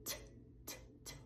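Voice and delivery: repeated airy T, no voice; unvoiced T sound. Shown as a demonstration. Teacher isolating the unvoiced T. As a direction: puff out T, just air. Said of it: "You're not using your voice".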